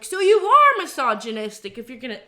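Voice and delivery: loud indignant re-enactment; retold accusation. Drunk clubber accusing the bouncer, mock indignant and loud.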